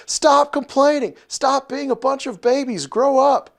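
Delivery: high-pitched mock scolding; exasperated Moses. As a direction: scold them like fed-up babysitting. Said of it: "how Moses is responding here".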